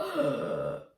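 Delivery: big gasping inhale demo; overdone gasp for air; exaggerated breath intake. Shown as a demonstration. Coach shows too much air, big audible gasp. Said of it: "It's not".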